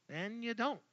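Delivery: normal talking voice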